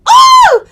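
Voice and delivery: high, loud whoop; a real delighted shriek; excited whoop. Real, not acted. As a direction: let out a thrilled ooh. Excited outburst about song.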